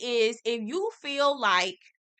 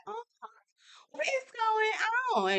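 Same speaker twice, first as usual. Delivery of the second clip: high, soft, baffled squeak; confused Stormy; 12 semitones higher, 4 dB quieter, faster than usual. Retold or imitated. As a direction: play Stormy totally lost. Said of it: "Stormy looking like".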